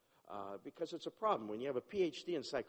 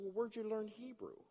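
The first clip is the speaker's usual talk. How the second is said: surprised question; 8 semitones higher than usual. Retold or imitated. Retelling his surprised question.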